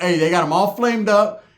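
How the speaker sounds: usual speaking voice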